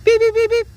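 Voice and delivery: high electronic beep, beep; imitating controller beeps. Shown as a demonstration. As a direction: squeak out beep, beep like a gadget. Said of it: "And it goes".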